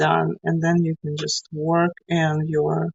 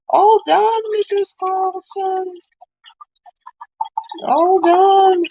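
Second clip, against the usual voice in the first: high sing-out yell of an eager pupil; imitated kid yelling; 13 semitones higher, slower than usual. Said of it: "he's already yelling".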